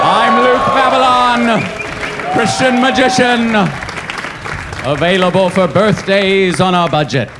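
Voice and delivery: high, loud, slow showman pitch; cheesy magician. Acted. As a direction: sell yourself like a cheap kids' entertainer. Mock magician self-introduction.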